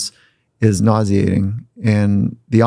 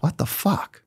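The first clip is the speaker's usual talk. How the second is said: higher, softer mock disbelief; bewildered players; 7 semitones higher, 8 dB quieter, slower than usual. Players grumbling to each other after practice, mock disbelief. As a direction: imitate baffled players under their breath. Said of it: "guys are like".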